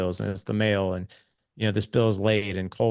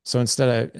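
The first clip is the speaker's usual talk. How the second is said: usual speaking voice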